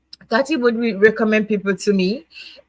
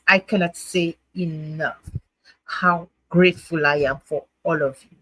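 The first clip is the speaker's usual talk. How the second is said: ordinary talk, nothing marked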